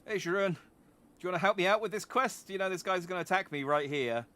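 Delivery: high, loud comic appeal; mock plea for help. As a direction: call over a companion, hammed up. Mimics asking a character for help.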